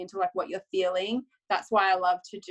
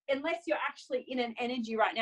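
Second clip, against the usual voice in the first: ordinary talk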